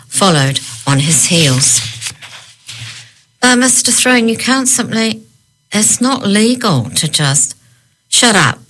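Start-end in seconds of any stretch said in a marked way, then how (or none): 3.4-7.5 high-pitched, anxious objection; flustered protest. A narrator's reading. Flustered protest against taking a shotgun. 8.1-8.6 curt growl, starting higher and falling; mildly angry silencing; growled command. A narrator's reading. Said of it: "he growled".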